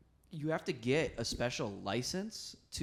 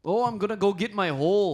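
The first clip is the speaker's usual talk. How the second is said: higher, louder mock Scottish line; mock Scottish accent; 7 semitones higher, 9 dB louder than usual. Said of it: "I love Scottish people".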